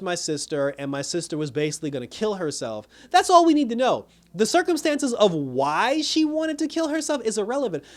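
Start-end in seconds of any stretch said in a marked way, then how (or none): 3.1-7.8 indignant outburst. Real, not acted. Venting about show plot.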